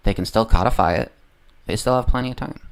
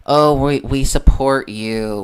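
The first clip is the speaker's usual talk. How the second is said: raised, drawn-out mock show of support; mock kowtowing; 4 semitones higher, 5 dB louder, much slower than usual. Mimicking politicians kowtowing to the left, mock fawning. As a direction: imitate them fawning, stretched out and higher. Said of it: "like, kowtow to the left and saying".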